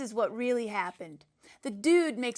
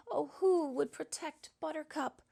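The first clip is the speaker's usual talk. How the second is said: melodramatic lament; 4 semitones higher than usual. Acted.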